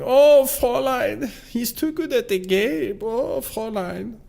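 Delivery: high-pitched mock-German lament; Albert. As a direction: wail it in a hammed-up German accent. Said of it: "He's going".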